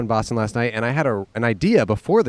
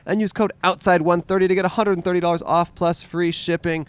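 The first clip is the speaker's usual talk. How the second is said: ad read; 6 semitones higher than usual. Acted. Ad read promo code.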